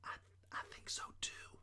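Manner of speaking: very soft, hesitant whisper. A narrator's reading. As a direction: whisper back with a small stammer. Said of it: "I whispered back".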